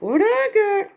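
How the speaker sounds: high, excited showing off. Acted. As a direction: pitch it up, eager. Teasing, showing off food.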